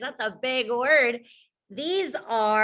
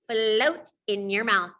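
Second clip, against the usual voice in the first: ordinary talk, nothing marked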